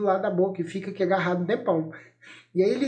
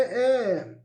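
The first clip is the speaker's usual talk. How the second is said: normal talking voice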